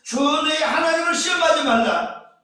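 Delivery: high, emphatic scriptural declaration; forceful command. Acted. Jesus answering the tempter, forceful command. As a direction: proclaim it as a stern command.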